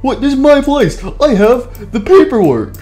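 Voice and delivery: high, indignant claim; affronted high-pitched protest; insistent. Acted. Owner asserts his claim.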